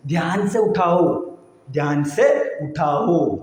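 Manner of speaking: "ധ്യാൻ സെ ഉഠാവോ" drawn out, word by word; slow, careful "Dhyan സെ ഉഠാവോ" for learners; slow teaching demo. Shown as a demonstration. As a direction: separate each word of "Dhyan സെ ഉഠാവോ" slowly. Teacher repeats phrase slowly for learners.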